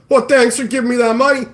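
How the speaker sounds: smug, hammed-up gratitude; mock freeloader. Streamer mocking an imagined freeloader, smug mock thanks.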